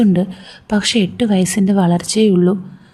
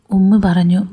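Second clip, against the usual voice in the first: usual speaking voice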